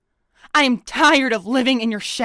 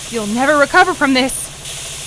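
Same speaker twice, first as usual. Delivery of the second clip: normal talking voice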